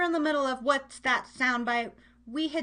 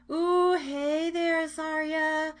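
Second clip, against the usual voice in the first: slow, higher, louder sing-song greeting; drawn-out hello; 4 semitones higher, 5 dB louder, much slower than usual. Real, not acted. Host greeting a friend on air, slow singsong hello.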